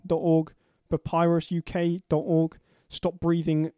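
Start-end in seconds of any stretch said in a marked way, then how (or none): none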